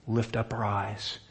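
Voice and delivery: slow soft whisper of encouragement; tender whisper. Acted. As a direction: whisper it gently as an invitation. Gentle call to lift eyes.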